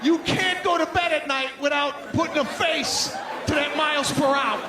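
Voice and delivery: laughing rant. Retold or imitated. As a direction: build it up as you pass. Building rant about other driver.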